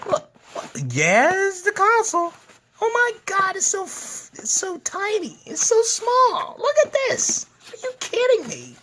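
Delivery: high-pitched cutesy squeal; squealing excitement. Real, not acted. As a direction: squeal over something tiny and cute. Gushing over the tiny console.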